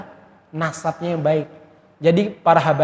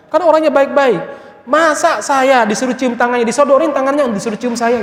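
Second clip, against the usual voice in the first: wife's voice pitched well up, loud and indignant; the wife protesting to her husband, pitched high and indignant; high upset wife; 8 semitones higher, 4 dB louder than usual. Retold or imitated. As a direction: play the offended wife, shrill with grievance. Wife's angry complaint.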